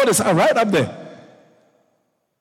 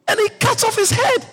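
rising preaching fervour; 12 semitones higher than usual. Acted. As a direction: drive the victory home. Climax of Goliath story.

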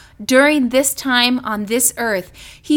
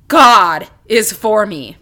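God said slow and louder; stress on GOD; 5 semitones lower, 5 dB louder, slower than usual. Shown as a demonstration. Preacher drilling the phrase, stress on God. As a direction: press the word God. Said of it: "emphasize one at a time".

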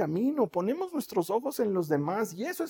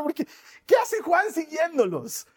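ordinary delivery

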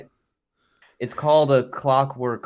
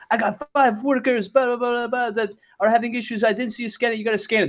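much higher, nagging voice; whiny coworker; 11 semitones higher than usual. Retold or imitated. Mocking the elevator coworker who wants the badge scanned, high and nagging. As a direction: mimic him listing excuses in a high pitch. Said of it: "he's like".

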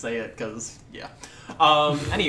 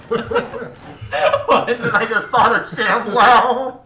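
laughing; 9 semitones higher, 8 dB louder, much slower than usual. Real, not acted. Laughing fit at the table.

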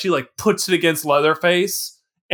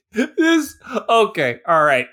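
normal talking voice

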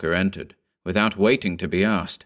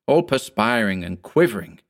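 usual speaking voice

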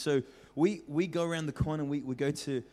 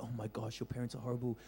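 sympathetic; 4 semitones lower, 10 dB quieter, faster than usual. Retold or imitated. Neighbours consoling the kids.

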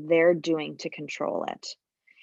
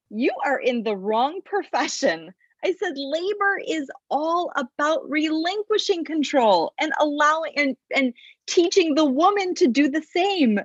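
laughing disbelief; 7 semitones higher than usual. Retold or imitated. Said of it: "unintentionally laughed at him and I said".

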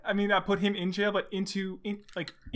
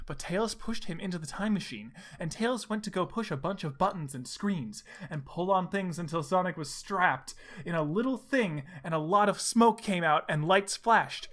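usual speaking voice

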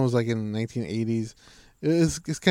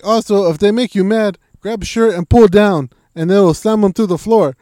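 much higher, much louder; loud fight tips; 8 semitones higher, 11 dB louder than usual. Retold or imitated. GSP explaining a shirt throw, loud and high. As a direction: bellow the throw advice. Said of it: "And he's like".

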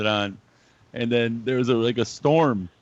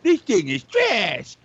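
mock collector voice; 10 semitones higher, 4 dB louder, slower than usual. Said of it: "instead of being like".

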